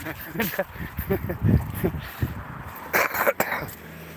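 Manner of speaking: real drawn-out chuckle; chuckling. Real, not acted. Chuckling at own remark.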